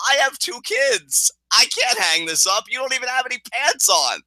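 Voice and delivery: high-pitched retold exasperation; Kevin Owens. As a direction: imitate a fed-up dad protesting. Acting out Kevin's exasperated objection.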